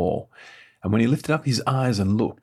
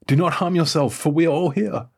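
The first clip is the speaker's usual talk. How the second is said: high, urgent raised cry; crying out loudly; 7 semitones higher, 4 dB louder than usual. A narrator's reading. Paul stops the jailer killing himself, crying out. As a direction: call out high to stop him. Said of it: "Paul cried with a loud voice".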